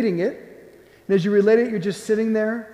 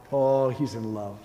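low, soft, adoring sigh of words; tender awe; 8 semitones lower, 6 dB quieter than usual. Acted. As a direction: drop low and soft, savouring it. Marvels at Jesus's response to honesty.

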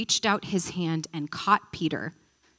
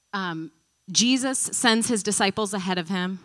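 usual speaking voice

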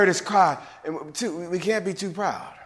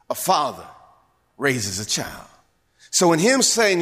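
ordinary delivery

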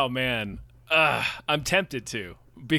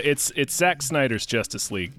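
mock stern; 9 semitones lower, 3 dB quieter than usual. Demanding respect for title.

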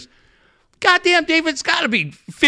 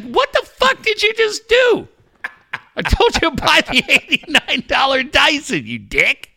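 angry wife; 11 semitones higher than usual. Retold or imitated. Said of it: "My wife's like".

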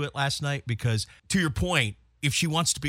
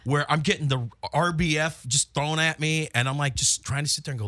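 ordinary talk

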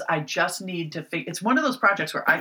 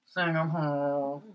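slow drawn-out; much slower than usual. Retold or imitated. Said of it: "I would always have to say".

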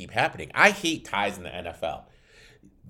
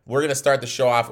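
ordinary talk

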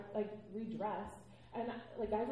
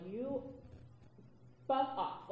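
retold scream; 6 semitones higher, much slower than usual. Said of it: "I just screamed".

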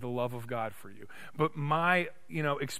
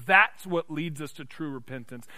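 ordinary talk

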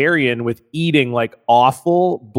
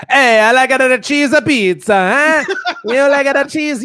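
hammed-up New York Italian accent, much higher and louder; bad New York accent; 11 semitones higher, 4 dB louder than usual. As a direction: camp up a New Yorker, sliding into Italian.

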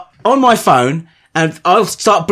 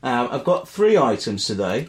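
turned away from the mic; words drifting off mic; 5 semitones lower, 5 dB quieter than usual. Real, not acted. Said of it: "Always talk to the mic, not away".

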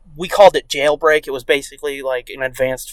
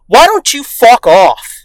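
loud, slow, higher-pitched telling-off; mock angry retort; 6 semitones higher, 8 dB louder, slower than usual. Neighbor brushes off dad's advice, mock angry. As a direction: imitate a hostile neighbor, drawn out.

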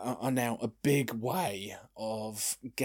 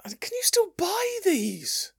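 very high, slow disbelieving question; genuinely amazed it still exists; astonished; slower than usual. Real, not acted. Amazed at old e-cig model.

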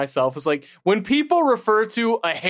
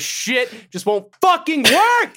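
exasperated yell at the computer; louder, higher, fed up; frustrated yelling; 8 semitones higher, 3 dB louder than usual. Real, not acted. Tech failing, yelling at computer.

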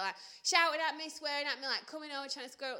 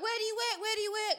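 high, louder pestering questions; insistent, higher-pitched heckling imitation; mock demanding; 8 semitones higher, 5 dB louder than usual. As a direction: mimic someone badgering you. Voicing girls' hostile questioning.

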